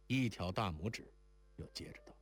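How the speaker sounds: ordinary delivery, nothing marked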